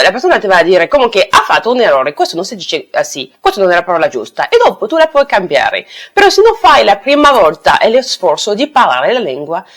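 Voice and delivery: normal talking voice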